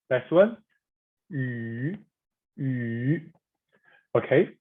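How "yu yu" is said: soft "yu" dipping, then rising; deep tone. Shown as a demonstration. Teacher leading practice on the next vowel, deep tone. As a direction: scoop "yu" gently down and up. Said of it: "Third is the deep tone".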